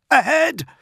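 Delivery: high, loud battle call; sudden high shout; shouted warning. A narrator's reading. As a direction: call out the direction urgently. Spotter calling directions in battle.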